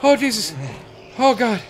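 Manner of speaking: high, loud repeated yelps of shock; startled screaming. Real, not acted. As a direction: yelp in fright, gradually easing off. Jump scare.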